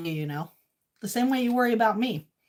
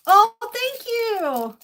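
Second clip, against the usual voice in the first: gushing thanks; 11 semitones higher, 5 dB louder, much slower than usual. Real, not acted.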